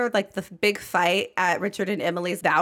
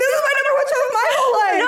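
much higher, louder, fast squeal; excited shriek; 9 dB louder, much faster than usual. Real, not acted. [name] echoes her co-host in a fast excited squeal. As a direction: squeals the same words right back.

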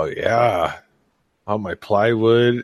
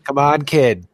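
mock Masshole; 6 semitones higher, 4 dB louder than usual. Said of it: "not talk like a masshole".

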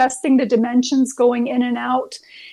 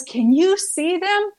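slow, raised pitch, leaning in confidentially; hushed eager question; 6 semitones higher, slower than usual. Retold or imitated. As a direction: ask it slowly and higher, like sharing a secret. Abigail leans forward, asks.

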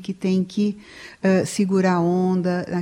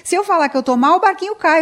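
higher, louder retold husband explaining himself; worried husband; 10 semitones higher, 5 dB louder than usual. Husband telling the psychologist why he hides his pain, higher insistent plea. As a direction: plead like a man holding up the boat. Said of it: "Ele fala assim".

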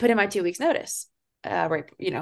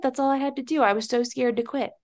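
ordinary talk

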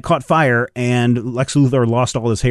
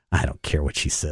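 put-on defiant kid; 8 semitones lower, 8 dB quieter than usual. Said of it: "except for the one kid who's like".

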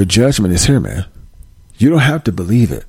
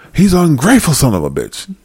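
angry dad; 6 semitones higher than usual. Acted. Acted dad after the shooting.

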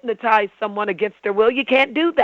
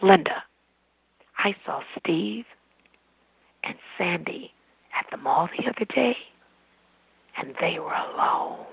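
hushed insinuating tone; 2 semitones lower, 6 dB quieter than usual. Shown as a demonstration. Speaker demonstrates how tone changes a message, hushed and insinuating. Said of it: "if I do this".

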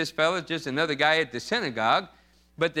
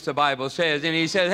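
usual speaking voice

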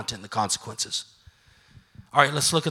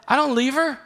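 laughing through the joke, higher and louder; 9 semitones higher, 3 dB louder than usual. Real, not acted. Joking to the congregation about his wife, laughing. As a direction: chuckle as you tell it.

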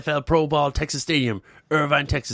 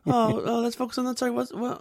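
much higher, whining; mock whiny Trump; 8 semitones higher than usual. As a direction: whine like a self-pitying president.

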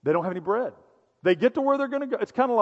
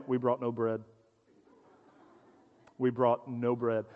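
lower, softer fretting; worried murmur; 8 semitones lower, 7 dB quieter, slower than usual. Retold or imitated. Disciples whisper among themselves about the bread, low and worried. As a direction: mumble it like guilty disciples.

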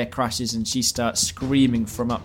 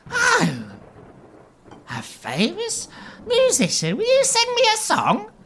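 very high, slow, gushing swoon; doe-eyed Bella; 3 dB louder than usual. Acted. Bella begs the famous musician for a song. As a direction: gush with doe eyes. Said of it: "looks at you with doe eyes and goes".

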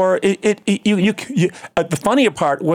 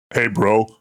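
put-on low gym-bro voice; 5 semitones lower than usual. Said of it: "the guys at the gym".

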